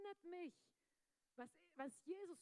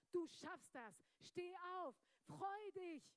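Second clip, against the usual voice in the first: encouraging Spirit; 5 semitones higher, 5 dB louder, slower than usual. Retold or imitated. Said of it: "die Stimme vom Heiligen Geist".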